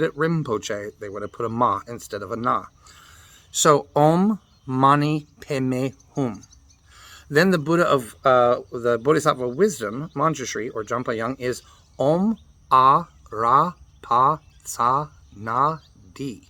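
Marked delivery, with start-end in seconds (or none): 3.9-6.4 "Om Mani Padme Hum" drawn out slowly, each syllable clear; slow careful mantra. Shown as a demonstration. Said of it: "repeat demonstration". 12.0-16.5 "Om A Ra Pa Tsa Na Di" slowly, syllable by syllable; slow mantra demonstration. Shown as a demonstration. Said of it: "teaching Manjushri mantra".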